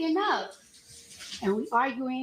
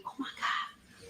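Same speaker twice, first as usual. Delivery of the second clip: hushed, slow whispered gasp; retold whisper; 8 dB quieter, slower than usual. A stranger whispering on spotting a celebrity. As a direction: breathe it out like a starstruck stranger. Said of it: "Then I hear her talking like".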